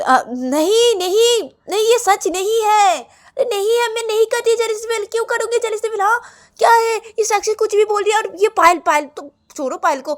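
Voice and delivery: very high, loud wail of protest; frantic denial. Acted. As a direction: cry out in desperate self-defence. Ananya desperately denies to everyone.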